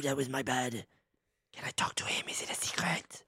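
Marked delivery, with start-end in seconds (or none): 1.5-3.2 unvoiced whisper. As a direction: whisper it into his ear. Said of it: "I'm whispering".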